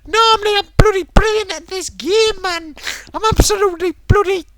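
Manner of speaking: high, loud mock whining protest; ranting streamer impression. Imitating streamer failing at a game, hammed-up whiny rant. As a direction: wail indignantly in a put-on high voice. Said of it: "when you catch him on the live streams".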